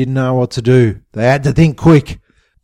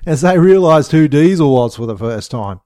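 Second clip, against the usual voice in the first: ordinary delivery, nothing marked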